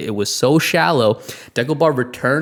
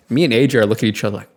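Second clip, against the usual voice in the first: ordinary delivery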